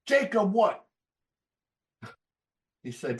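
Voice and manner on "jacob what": high, louder mock demand; hammed-up grumpy grandpa. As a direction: imitate the old man pressing for the name.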